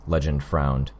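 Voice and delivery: ordinary delivery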